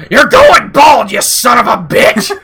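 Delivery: furious high-pitched yell, slow on "going bald"; Vince McMahon yelling. Retold or imitated. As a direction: bellow it like a raging boss. Vince yells at Baron Corbin.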